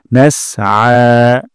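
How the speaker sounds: "নাসআ" ending in a drawn throaty আ; very slow, pull the আ from mid-throat; long throaty aa. Shown as a demonstration. Teacher demonstrating the throat sound. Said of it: "এক আলিফ টান গলার মাঝখান থেকে".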